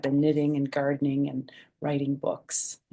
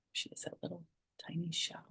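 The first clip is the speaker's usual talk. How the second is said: breathy whispered aside; 14 dB quieter, faster than usual. Real, not acted. Grandma whispering about the tiny shell, hushed. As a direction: hush it like a secret.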